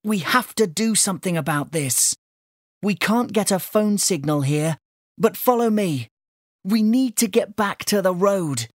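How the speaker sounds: put-on girl's urgent plan; urgent April. A narrator's reading. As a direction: lead the way with urgency. April urges action.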